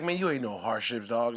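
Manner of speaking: low Tyson impression. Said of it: "he was like".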